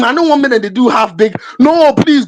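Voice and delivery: normal talking voice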